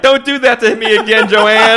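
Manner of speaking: high, loud hammed-up movie shout; mock outburst. Buddy standing up to quote the movie, mock outburst. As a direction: yell it like a melodramatic film line.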